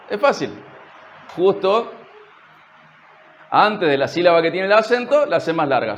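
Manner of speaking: ordinary talk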